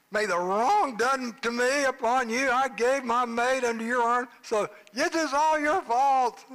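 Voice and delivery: the offended wife scolding her husband; indignant accusation. Acted. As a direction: pin it all on him, indignant. Wife blaming husband, acted quote.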